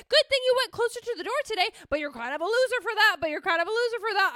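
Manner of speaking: high, loud, repetitive mental spiral; frantic inner voice. Acted. Her brain panicking in hot yoga class, frantic. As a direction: panicky racing thoughts, hammed up. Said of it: "brain's gonna keep doing backflips being like".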